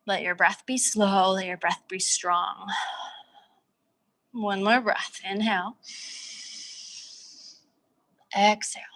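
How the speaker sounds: calm, unhurried breath cues with long pauses. Acted. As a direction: let each cue settle before the next. Slow breath cues, guided calm.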